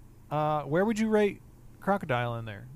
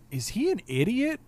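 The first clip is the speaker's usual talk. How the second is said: high-pitched retold question; incredulous; 9 semitones higher than usual. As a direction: imitate someone baffled by the silliness. Retelling Isa's incredulous question.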